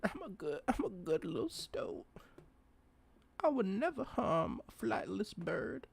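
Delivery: high, small pleading creature voice; cute high-pitched stoat voice; innocent little stoat. Acted. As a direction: plead innocence as a tiny animal. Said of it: "He's like".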